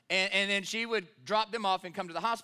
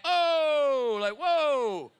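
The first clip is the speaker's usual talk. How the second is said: loud, high startled "Oh... Whoa"; mock loud surprise; 7 semitones higher, 8 dB louder, much slower than usual. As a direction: call out a smell in shock. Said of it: "call it out like".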